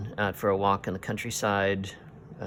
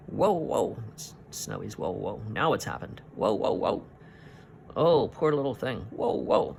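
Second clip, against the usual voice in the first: lightly voiced woof-like whoa; dog barking words. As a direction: bark it out like a little terrier. Snowy barking in the story.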